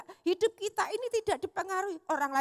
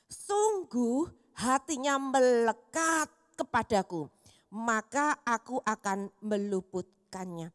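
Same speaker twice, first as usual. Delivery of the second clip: slow, solemn scripture reading; 3 semitones lower, 3 dB louder, slower than usual. Acted. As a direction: read the verse slowly and reverently. (bacaan ayat Alkitab yang pelan dan khidmat)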